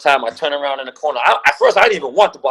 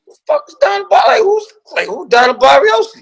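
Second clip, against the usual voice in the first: high-pitched baffled squawk; incredulous outburst. Retold or imitated. Draftee hearing his name misread, incredulous. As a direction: blurt it way up high in disbelief.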